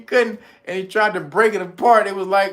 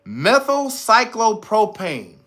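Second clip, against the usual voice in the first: slow, emphatic long word; careful enunciation; 3 semitones higher, 3 dB louder, slower than usual. Shown as a demonstration. Introducing a long chemical word, careful enunciation. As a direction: sound out methylcyclopropane with weight. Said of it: "listen to this word".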